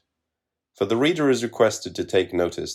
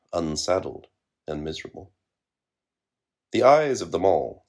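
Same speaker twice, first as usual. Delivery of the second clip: ordinary delivery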